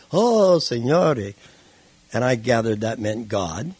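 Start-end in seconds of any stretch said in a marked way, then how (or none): 0.1-1.3 slow, drawn-out, louder prayer to God; fervent Italian prayer. Retold or imitated. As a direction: stretch out each word like an old man praying in tears. Old Italian man praying aloud.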